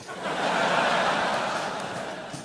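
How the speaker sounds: quiet breathy chuckle; breathy laugh. Real, not acted. Laughing at own joke.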